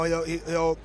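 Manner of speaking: ordinary talk, nothing marked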